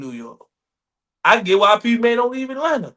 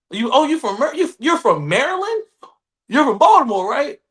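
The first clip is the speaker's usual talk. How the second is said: raised, louder voice of a curious stranger; mock-surprised, presumptuous asking; imitated eager questioning; 7 semitones higher, 5 dB louder than usual. Strangers assume Marylanders come from Baltimore, imitated eager questioning.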